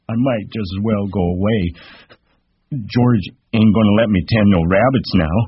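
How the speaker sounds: high, simple-minded lament; dejected Lenny. Acted.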